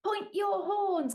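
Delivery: loud, slow, high command; rally the herd; rallying call. Acted. Cleo rallying the unicorns, loud slow call.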